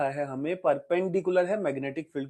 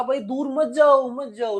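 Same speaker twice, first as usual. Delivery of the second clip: mock plea, pitched up high; pleading coil; 7 semitones higher, 5 dB louder than usual. Teacher voices the coil begging the magnet not to leave, mock pleading. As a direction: call out louder at first, then ease off. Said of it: "तो इसको कहा".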